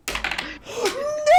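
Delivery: sharp, high gasp of dismay; high shocked gasp. Real, not acted. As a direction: gasp as if the dice betrayed you. Dismay at a dice roll.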